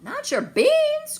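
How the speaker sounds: high, loud, slow shocked cry; outraged cry. Acted. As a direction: cry out in horror over the beans. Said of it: "cried Skippy John Jones, outraged".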